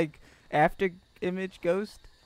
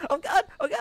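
horrified surprise; 5 dB louder, faster than usual. Real, not acted. Ghost appears.

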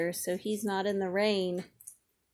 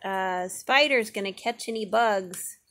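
ordinary talk, nothing marked

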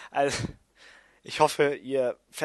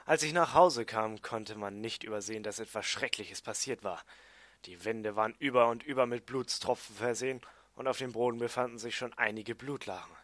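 measured, subdued story reading; audiobook narration; 5 semitones lower, 5 dB quieter than usual. A narrator's reading. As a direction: read a dark tale aloud, evenly. Reads own story as audiobook.

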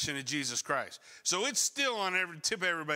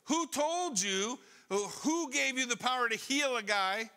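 raised pitch, demanding authority challenging someone; indignant interrogation; 5 semitones higher than usual. Acted. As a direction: fire off accusing questions, pitched high. Acting out the rulers' challenge.

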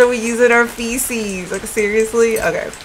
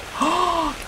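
soft gasp; 8 semitones higher, 6 dB quieter than usual. Real, not acted. Alarm at missing fire pit.

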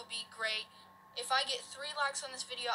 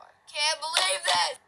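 frustrated yell; 6 semitones higher, 9 dB louder than usual. Real, not acted. Loses the game, frustrated.

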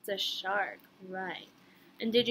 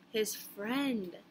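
ordinary delivery, nothing marked

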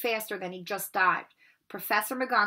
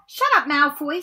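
put-on sharp, loud, higher girl's snap; snapping; 6 semitones higher, 9 dB louder than usual. Parvati snaps at Malfoy for mocking Neville, sharp and loud. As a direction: bite back at the bully. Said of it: "snapped Parvati Patil".

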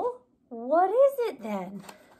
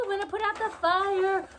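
usual speaking voice

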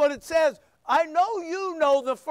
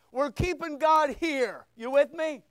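usual speaking voice

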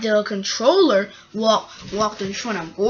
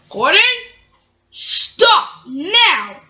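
raised, louder command to stop; stern order; 8 semitones higher, 4 dB louder, much slower than usual. Acted. Controller orders Gordon to stop, sternly. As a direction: order it sharply, higher pitched.

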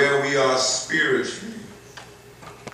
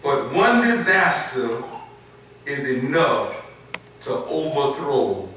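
scripture reading; 2 semitones higher, 4 dB louder, slower than usual. Acted. Slow emphatic scripture reading.

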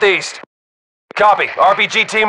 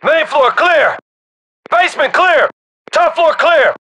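higher-pitched military shout; called-out report; 6 semitones higher, slower than usual. Acted. Soldier reporting floors clear to the team, high shouted call. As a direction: call it through the house to the team.

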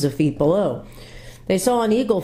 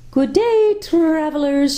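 high, sweet, ringing greeting; sweet birdlike lady; 12 semitones higher, 3 dB louder, slower than usual. A narrator's reading. Lady hailing the travelers, high and sweet. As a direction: call out sweetly like birdsong. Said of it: "she cried out in a voice as sweet as the sweetest bird song".

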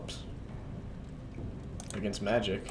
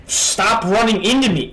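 loud, higher angry shout; angry yell; 5 semitones higher, 7 dB louder than usual. Real, not acted. Gamer yelling at a boss shoving him aside, angry yell. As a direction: yell it at the enemy in frustration.

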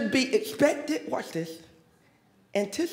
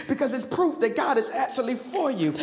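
ordinary talk

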